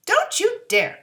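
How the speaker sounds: sharp high shout of protest; angry cry. A narrator's reading. Girl shouts at lion to protect her dog, angry cry. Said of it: "cried Dorothy".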